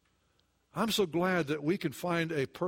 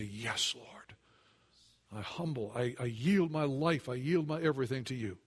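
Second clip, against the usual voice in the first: hushed prayer; 6 semitones lower, 4 dB quieter than usual. Acted. Prayer of surrender acted out.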